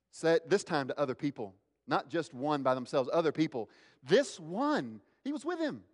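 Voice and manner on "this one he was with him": high-pitched finger-pointing; accusing. Acted. Bystander telling others about Peter, high-pitched accusing. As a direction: voice climbing sharply as you single him out.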